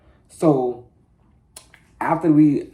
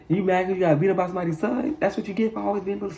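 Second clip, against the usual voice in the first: mom taunting; 6 semitones higher, 3 dB quieter than usual. Retold or imitated. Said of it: "So my mama like".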